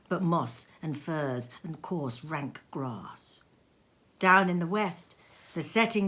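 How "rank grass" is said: soft unvoiced whisper. A narrator's reading. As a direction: let it fade to a whisper. Whispered narration.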